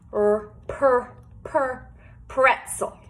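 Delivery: "Per, per, per" repeated, then "pretzel" slowly; "pretzel" drawn out slowly after the repeated start; sounding out. Shown as a demonstration. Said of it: "This first one is a pretzel".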